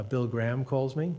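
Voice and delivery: ordinary delivery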